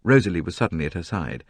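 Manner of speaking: ordinary delivery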